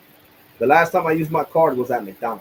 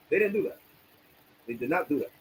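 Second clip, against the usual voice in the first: ordinary talk, nothing marked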